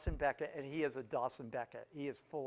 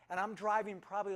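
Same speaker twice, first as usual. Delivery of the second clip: ordinary talk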